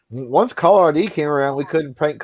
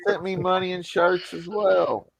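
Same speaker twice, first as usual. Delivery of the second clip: higher-pitched impression; Jake Hudson; 6 semitones higher than usual. Softening the insult to Brad, in a put-on higher voice. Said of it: "I can do the Jake Hudson".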